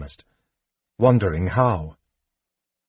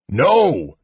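raised, drawn-out cry of no; alarmed yell; 4 semitones higher than usual. A narrator's reading. Dwarf warning kender of attacking cleric, alarmed yell. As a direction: yell a higher-pitched warning. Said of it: "Flint yelled".

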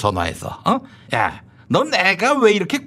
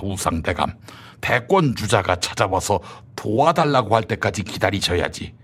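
normal talking voice

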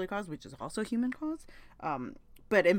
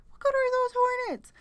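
much higher and louder, near a shriek; exasperated outburst; 5 dB louder than usual. Real, not acted. Vlogger repeating her rant to viewers, shrill outrage. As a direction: throw the question up in real exasperation. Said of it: "I'm sorry".

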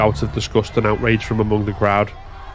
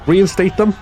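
high, loud rallying demand; demanding shout; 11 semitones higher, 5 dB louder than usual. Acted. Striker leading the crowd's demand, rallying shout. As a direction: start the chant.

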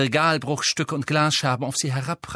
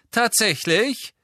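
Harry voice; 10 semitones higher, 3 dB louder, slower than usual. Acted. Harry challenges Malfoy.

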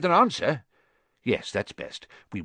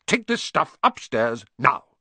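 loud, high, impatient order; brusque angry command; snapped; 6 semitones higher, 5 dB louder than usual. A narrator's reading. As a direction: order the boy off, landing on the last word. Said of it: "snapped his uncle".

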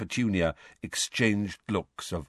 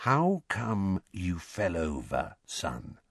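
soft, slow bedside voice; hushed, careful, dropping away at the end; unnaturally quiet; 6 dB quieter, slower than usual. A narrator's reading. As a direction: ask as if beside a very ill person. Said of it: "in an unnaturally quiet voice".

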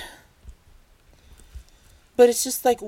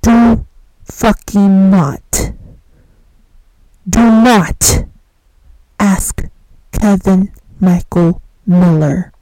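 slow, much louder warning, each word hammered; mock dramatic shout; 12 dB louder, much slower than usual. Vlogger loudly warns viewers with a comic slow shout. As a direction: bellow the warning like a running gag. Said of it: "I'm gonna do what I have this running gag".

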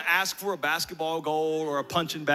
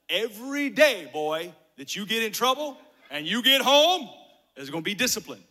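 higher, louder warning to a son; mock stern dad; 4 semitones higher, 7 dB louder than usual. Dad warning his boy about trouble at home, mock stern. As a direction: lay down the law, playfully loud.

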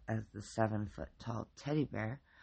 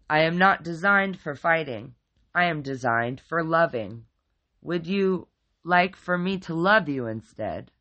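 loud, booming formal pronouncement; loud booming teddy bear; 4 semitones higher, 11 dB louder than usual. A narrator's reading. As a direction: boom it so it shakes the room.

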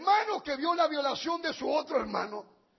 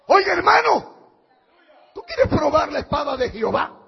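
usual speaking voice

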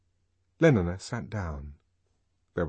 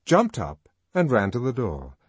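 ordinary delivery, nothing marked

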